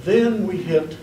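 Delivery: ordinary talk, nothing marked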